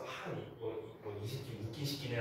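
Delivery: soft unvoiced grumble; muttering seniors. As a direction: mutter under the breath about him. Seniors mutter among themselves. (선배들끼리 낮게 투덜대듯)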